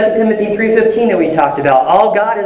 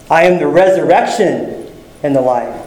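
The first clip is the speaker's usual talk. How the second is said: "resurrection" stands out from the rest; stress on RESURRECTION; 3 semitones lower than usual. Shown as a demonstration. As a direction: hit "resurrection", brief pause, then drop off. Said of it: "you just emphasize a different word".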